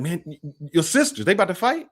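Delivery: stammering, high-pitched startled question; mock-concerned onlooker; imitated alarm. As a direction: stumble into a worried question, rising at the end. Retold onlooker alarmed about his sisters.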